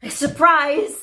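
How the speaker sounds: loud, high exclamation; mock-cheery reveal. Hit lady revealing herself to her date, loud surprise.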